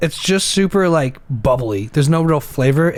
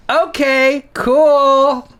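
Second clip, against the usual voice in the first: high-pitched fake cheer; imitated super-awkward upbeat reply; trying to sound fun; 10 semitones higher, 3 dB louder, much slower than usual. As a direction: overdo the breezy enthusiasm, awkwardly. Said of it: "sounding fun about it".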